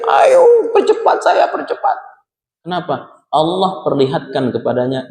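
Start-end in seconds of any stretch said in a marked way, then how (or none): 0.1-2.0 retold cry. Good person's corpse calls to its bearers, imitated high cry. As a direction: imitate someone crying out to be hurried along. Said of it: "Dia teriak tuh".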